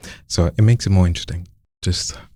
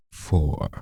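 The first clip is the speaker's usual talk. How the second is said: vocal fry; 2 semitones lower, 6 dB quieter than usual. Shown as a demonstration. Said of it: "vocal fry at the end".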